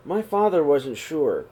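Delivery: ordinary delivery, nothing marked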